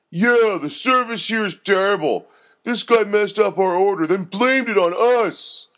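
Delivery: high, loud thug complaining; whiny thug griping about service. A narrator's reading. First thug complaining to the manager, high and loud. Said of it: "said the first thug".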